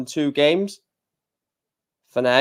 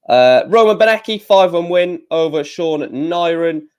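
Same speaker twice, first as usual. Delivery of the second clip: ordinary delivery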